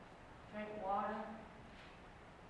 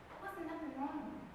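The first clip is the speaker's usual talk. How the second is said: hushed, slightly defensive statement; quiet insistence; 9 dB quieter than usual. Acted. Narrator defends the man, quietly. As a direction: say it softly, almost to oneself. First take, softly said.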